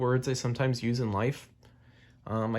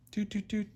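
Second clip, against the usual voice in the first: hummed doot sounds; 9 semitones higher, 8 dB quieter than usual. Acted. Sound effect while writing tilde.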